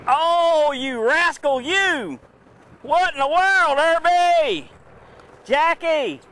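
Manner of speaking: high, loud surprised yell; excited shout. Real, not acted. Sudden big fish strike.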